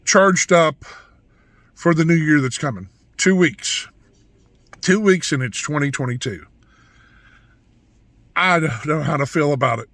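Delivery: ordinary talk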